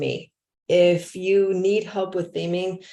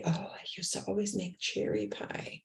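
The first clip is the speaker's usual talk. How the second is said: reminiscing resident; 3 semitones higher, 12 dB quieter than usual. Retold or imitated. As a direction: recall it softly, as an imitated care-home resident. Voices resident recalling pie baking.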